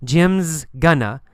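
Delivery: slow "Jim's gonna" with a clear buzzing z; careful demo of contracted "Jim's gonna"; reduced form with z. Shown as a demonstration. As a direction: hold the z in "Jim's", then "gonna". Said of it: "you need the z sound there".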